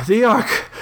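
high, breathless exclamation; winded and urgent, voice up high; puffing from exertion. A narrator's reading. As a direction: gasp it out after running. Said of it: "puffing and wheezing with the exertion".

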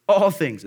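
loud, with "All" drawn out; emphatic stress on ALL. Shown as a demonstration. Preacher answering own question, stress on ALL. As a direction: answer firmly, hitting a long "All". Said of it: "Again, double emphasis on this".

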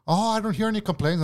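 high-pitched imitation of her protest; mock defensive coworker. Coworker denying she burns anything, mock defensive. Voicing coworker's denial in quarrel.

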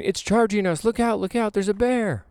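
high, alarmed warning; panicked campers. Retold or imitated. Vlogger retelling campers' alarm, high panicky calls. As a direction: call out like bystanders fleeing. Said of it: "People are saying".

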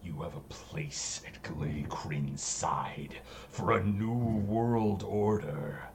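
low, sinister eldritch whisper; whispering entity. Acted. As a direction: hiss it like an ancient evil.

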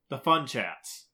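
ordinary delivery

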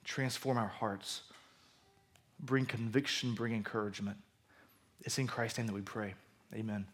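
soft, low prayer voice; hushed opening prayer. Real, not acted. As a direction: pray it quietly and reverently. Pastor opens with a prayer.

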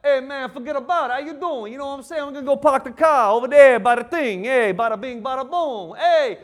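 put-on a touch of a loud New York accent; light mock New York talk; Northeast accent. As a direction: play a brash Northeast guy. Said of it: "you go up to the Northeast".

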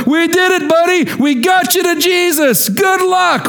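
high, bright cheer of proud friends; cheery friends. Retold or imitated. Friends lowering the paralyzed man call out cheerfully. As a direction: cheer him off like proud buddies.

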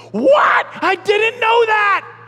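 high, loud hammed-up disbelief; mock shock. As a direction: overplay a shocked, outraged God. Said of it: "God's gonna say".

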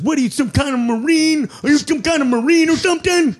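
high, slurred shout of disbelief; high-pitched, thick-tongued yelling; imitated impaired speech. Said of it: "sounds mentally handicapped".

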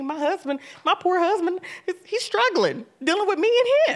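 growing urgency about the husband; rising intensity. Real, not acted. Describing husband struggling, rising intensity.

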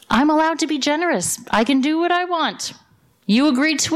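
landowner insisting. Acted. Landowner answers the complaining workers.

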